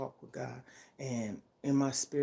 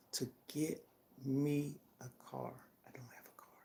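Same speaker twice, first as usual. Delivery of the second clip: trailing whisper; 8 dB quieter than usual. Real, not acted. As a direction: fade to a breathy whisper, unvoiced. Wishing for a car at the end.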